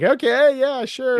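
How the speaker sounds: a fed-up tattoo artist brushing him off; annoyed tattoo artist. Retold or imitated. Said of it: "the tattoo artist is like".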